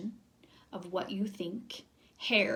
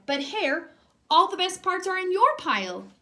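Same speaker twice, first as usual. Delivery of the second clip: higher, louder complaining Bear; upset Bear; 8 semitones higher, 6 dB louder than usual. Acted. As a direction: protest it, loud and high. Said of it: "Bear stared at his pile".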